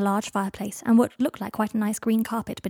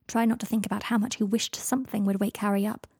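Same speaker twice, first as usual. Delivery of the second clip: plain reading voice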